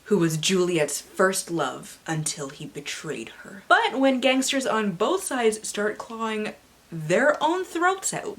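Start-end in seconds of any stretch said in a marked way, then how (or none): 3.7-8.4 slow, rising much higher on throats out; dramatic reading. Acted. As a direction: build the horror.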